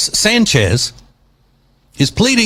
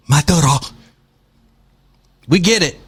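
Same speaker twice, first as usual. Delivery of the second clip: usual speaking voice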